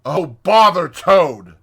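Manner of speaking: loud, slow, savage outburst; high, furious Rat. A narrator's reading. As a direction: explode in exasperation, dragging the words. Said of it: "said the Rat savagely".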